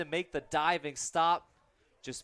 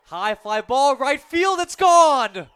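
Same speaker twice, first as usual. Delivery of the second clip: rising, roaring high home run call; 9 semitones higher, 13 dB louder, slower than usual. Real, not acted. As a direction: build to a huge shout on gone. Announcer calls a home run.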